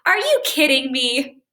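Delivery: light, amused teasing ask; playful joking question. Shown as a demonstration. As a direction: ask it like you're in on a joke. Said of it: "So here's the first way".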